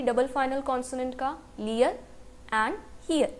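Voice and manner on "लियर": usual speaking voice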